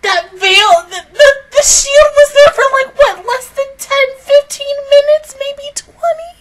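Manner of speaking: high, strained excited ranting. Real, not acted. Fan gushing to viewers about the shield.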